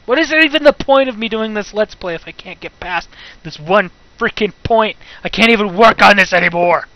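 loud, exasperated complaint; fed-up rant. Real, not acted. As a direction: raise your voice in real frustration. Fed up with being stuck.

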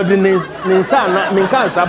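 normal talking voice

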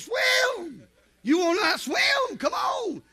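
high, drawn-out hollering; acted goading holler. Dad to son in the water, acted goading holler. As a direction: goad him from the boat, calling out.

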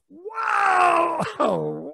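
high drawn-out shout of awe, then dropping; amazed wow. Acted. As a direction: draw it out like a stunned crowd. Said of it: "people are like".

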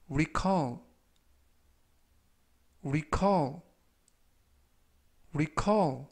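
recall, said slowly and clearly with a falling end; careful teacher's recall, stress on the second syllable; model pronunciation. Shown as a demonstration. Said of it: "이라고 발음하시면 되겠고요".

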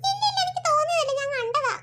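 a small daughter, wide-eyed and a little scared; child voice describing a strange shape; little girl voice. Acted. Daughter insists.

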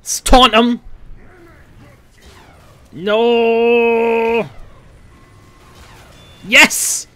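high, loud excited yelling; thrilled shouting over a taunt; excited shouts. Real, not acted. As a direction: cheer it out loud. Wild moment in the match.

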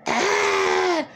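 high, loud crying shriek of the abuser; screaming abuser. Retold or imitated. Ex-husband screaming at her for opening the fridge, shrill shriek. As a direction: shriek it like a raging ex. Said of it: "and then I was screamed at".

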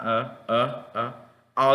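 relaxed-mouth "ah", like uh; strong schwa. Shown as a demonstration. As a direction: let the tongue sit central on each "ah". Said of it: "con la boca relajada".

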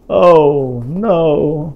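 loud, high cry of dismay; dramatic alarm. Acted. Parent reading to child, gasping at the spicy salsa. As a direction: wail it like disaster is coming. Discovering the jalapeno peppers.

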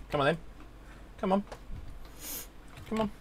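ordinary talk, nothing marked

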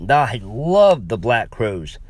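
plain reading voice

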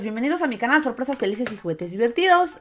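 sing-song greeting to little friends; bright squeaky sing-song welcome. Real, not acted. Kids channel opening greeting.

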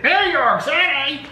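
high, drawn-out mock outcry; commercial catchphrase imitation. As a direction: cry it out slowly like the salsa ad. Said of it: "There's a commercial".

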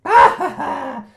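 slow, loud hammed-up cackle; villain laugh. Joker mocking Bruno on the phone, slow loud cackle. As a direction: savour the laugh like a cartoon villain.

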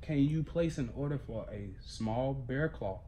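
ordinary talk, nothing marked

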